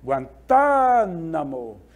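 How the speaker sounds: Guantánamo with TÁ held very long; Guantánamo, slowly, leaning long on the TÁ; stress on TÁ. Shown as a demonstration. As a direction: stretch the TÁ in Guantánamo. Demonstrates the stressed syllable of example.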